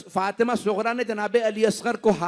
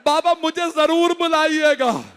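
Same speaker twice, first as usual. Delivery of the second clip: pleading daughter; 9 semitones higher, 7 dB louder than usual. Acted. Daughter begs father to invite her.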